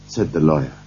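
plain reading voice

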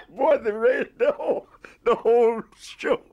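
high, loud, helplessly amused; real laughter. Real, not acted. Recalling the orchestra's reaction to the joke, laughing. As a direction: tell it through a big laugh.

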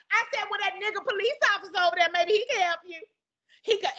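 high, mocking retelling; retold mockery. Cashier gloating to coworkers, high and mocking. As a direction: gloat over her mistake.

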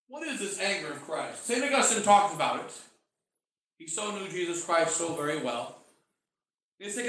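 ordinary talk, nothing marked

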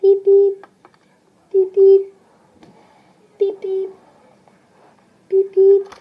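loud high beep beep; imitated phone ring. Junior calling a friend, loud phone-ring beeping.

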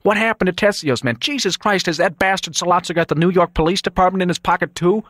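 high, urgent, rising alarm; agitated disbelief. Acted. Son on the phone about missing guards, agitated.

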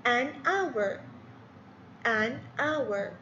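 "an hour" with a silent h, "an" linked to the vowel; teacher models "an hour"; model phrase. Shown as a demonstration. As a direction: say "an hour" with no h, linking an-our. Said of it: "ال اتش ما ينطق، اور، إذن ان".

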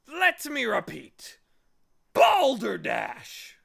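loud, high, blustering bellow; shouting. A narrator's reading. Humbug repeating his objection to the bee, blustering shout. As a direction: declaim it slowly at full volume. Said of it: "he shouted again".

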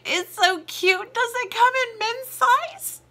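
high squeal, mock-girly gush; squealing excitement. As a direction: squeal it like a fangirl. Gushing over product, mock.

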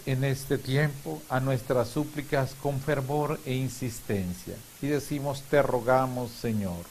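ordinary talk, nothing marked

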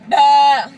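loud bleating goat noise. Retold or imitated. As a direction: bleat like a goat, full volume. Repeating her goat bleat.

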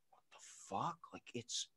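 hushed, bewildered mutter; baffled. Retold or imitated. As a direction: say it under your breath, taken aback. Bewildered reaction to midnight call.

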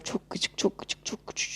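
grumbling whisper to himself. Real, not acted. Repeats phrase then whispers.

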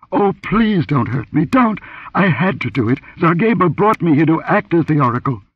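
high-pitched, terrified begging; hysterical pleading. A narrator's reading. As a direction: plead desperately as if near hysteria. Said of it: "her sobs quivered with a note of hysteria".

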